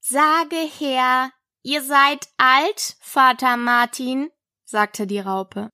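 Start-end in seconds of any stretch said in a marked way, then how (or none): 0.0-4.3 slow, higher, ponderous command; drawling caterpillar voice; languid caterpillar. Acted. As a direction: stretch each word while prompting the pupil. Caterpillar orders a recitation.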